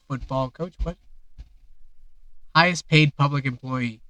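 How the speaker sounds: ordinary talk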